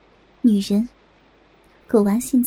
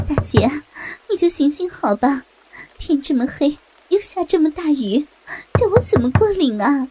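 raised-voice plea through a shut door; desperate appeal, short of a full shout; pleading call; 3 semitones higher than usual. Acted. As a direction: call out imploringly, pitch lifted. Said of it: "大声地呼喊".

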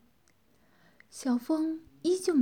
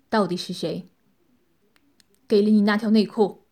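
压低音高、提高音量冷声逼问; 像审讯一样冷硬地追问; 冷冷质问; 7 dB louder than usual. A narrator's reading. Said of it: "冷冷地说道". (冷冷地厉声质问)

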